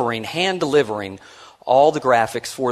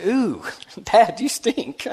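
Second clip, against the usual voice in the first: put-on high, childlike, with a giggle; five-year-old voice; 9 semitones higher, much slower than usual. A five-year-old in his lap telling Dad he stinks, high and giggly. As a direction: tease Dad like a small child. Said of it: "and then said".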